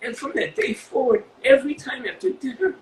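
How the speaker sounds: high, halting, on the edge of tears; choked up. Real, not acted. Interviewee finishing an emotional fan story, choked up. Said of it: "This, this fucks me up".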